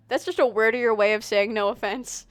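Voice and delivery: ordinary delivery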